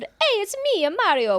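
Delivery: high squeaky Italian plumber; Mario voice. Retold or imitated. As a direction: chirp it like Mario. Imitating Mario catchphrase.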